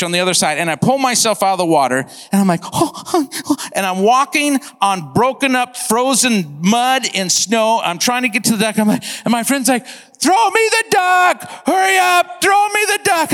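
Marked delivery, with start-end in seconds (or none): 2.6-3.6 high, short "oh" gasps; imitated freezing gasps. Swimmer climbing out of the river, mock freezing gasps. 9.9-13.3 high, drawn-out holler across distance; acted shouting. Friend yelling across the river for the duck, acted shout.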